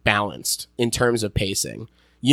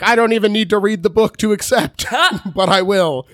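higher-pitched quote with a laugh breaking in; eager actress, lightly acted; 8 semitones higher than usual. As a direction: play her keen to accept, half laughing. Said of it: "she was like".

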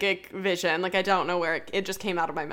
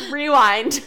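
playful call; 5 semitones higher, 9 dB louder than usual. Real, not acted.

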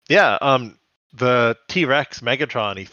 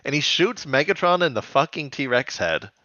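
ordinary talk, nothing marked

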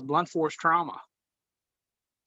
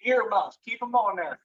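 raised promo warning; wrestling promo voice; 5 semitones higher than usual. Pretend. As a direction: call out like a wrestler. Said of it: "to cut a promo on your boy right now".

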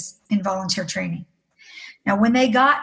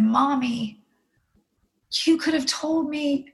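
higher, tender daughter's voice; consoling daughter; 4 semitones higher than usual. Retold or imitated. Oldest daughter comforting her mother after the confession, tender. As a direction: reassure gently, pitched up like a girl. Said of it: "And she said".

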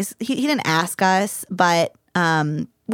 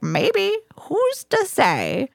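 put-on a higher, slow old man voice; 12 semitones higher, much slower than usual. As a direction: drawl it like a coy old grandpa. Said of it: "he was like".

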